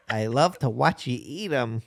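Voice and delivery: put-on slow, light sweet old lady; a hint of a doting old lady; imitating an old lady. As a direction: dote on him like a grandma. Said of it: "the old lady gives him the meatballs".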